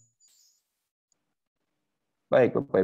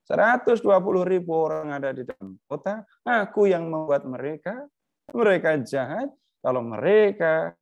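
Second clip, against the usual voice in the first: God challenging Jonah, raised and insistent; God rebuking Jonah; 8 semitones higher than usual. Acted. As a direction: press the point as God correcting a sulking prophet. Voices God about Nineveh.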